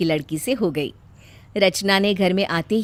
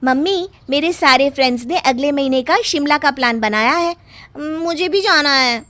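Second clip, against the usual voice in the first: a higher, lightly voiced girlish ask; pampered daughter; 5 semitones higher, 3 dB louder than usual. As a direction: coax mum for the trip. Daughter asks permission.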